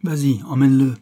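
officer ordering soldiers aloud; loud order. Acted. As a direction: order them to take him away. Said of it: "dit-il à l'adresse des soldats".

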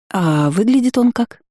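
mildly cautious question, drawn out and lifted. A narrator's reading. Friend asks about the forgotten husband's looks, cautious. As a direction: feel out the answer carefully. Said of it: "осторожно спросила она".

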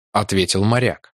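ordinary delivery, nothing marked

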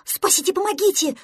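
high, loud plea for rescue; panicked cry. Acted. Prostodursen flees pretend lion, panicked cry. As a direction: scream for help in panic.

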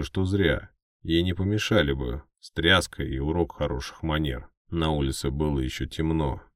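usual speaking voice

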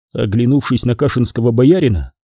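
plain reading voice

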